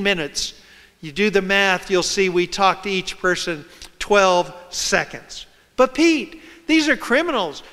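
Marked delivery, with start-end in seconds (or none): 5.8-7.6 higher-pitched imitated objector; mock objection. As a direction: protest as a doubter would, pitched up. Voicing an objector to himself.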